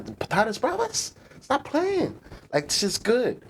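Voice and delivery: squeaky high, gushing praise; excited high voice. Pretend. To chat, gushing over patatas bravas in a squeaky high voice. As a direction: rave about the dish up high.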